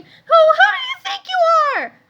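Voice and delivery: very high, loud, slow scream; secretary screams at the boy, very high. A narrator's reading. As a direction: scream in outrage. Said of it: "rapidly becoming a scream".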